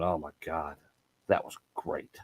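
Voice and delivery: hushed, amazed inner thought; breathless whispered wonder; awed whisper. Retold or imitated. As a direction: whisper that was great under your breath. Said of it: "excitement in your mind going".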